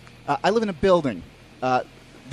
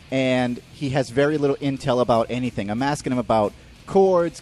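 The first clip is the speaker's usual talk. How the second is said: ordinary delivery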